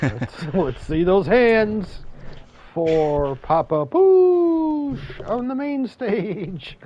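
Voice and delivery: loud, slow hype announcer; strip club DJ. Acted. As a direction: stretch every word like a club emcee. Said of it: "you sound like a D J at a strip joint".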